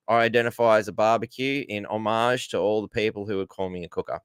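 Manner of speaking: ordinary delivery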